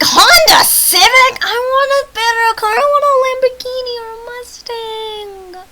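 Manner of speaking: high, loud whiny protest; mock outrage. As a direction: wail it in exaggerated disbelief, stretching the last word. Mock outburst over the car.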